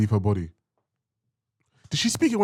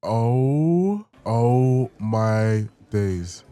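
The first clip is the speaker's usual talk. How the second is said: surprised; 3 dB louder, much slower than usual. Real, not acted. Reacting to shocking scene.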